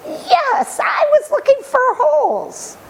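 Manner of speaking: put-on high small boy's voice; a young boy admitting to the maker he was looking for holes, high child voice; child's voice. A narrator's reading.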